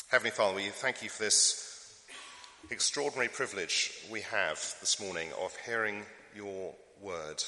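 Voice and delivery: soft, reverent prayer voice. Acted. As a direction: pray gently over the congregation. Leads the congregation in prayer.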